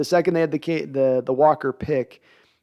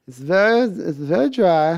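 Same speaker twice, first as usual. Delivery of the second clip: slow German accent; mock German accent, slow; a rough German accent attempt; 3 semitones higher than usual. Shown as a demonstration. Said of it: "the German accent needs a little work".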